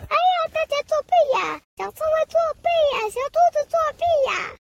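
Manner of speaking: high, fast, whining accusations; sore loser whine. Acted. Squirrel loses and accuses winner of cheating, high fast whine. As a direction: cry foul like a bratty kid. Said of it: "他说".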